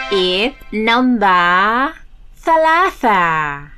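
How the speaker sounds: put-on broad singsong kids theatre. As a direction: sing it out to little viewers. Kids show presenter voice.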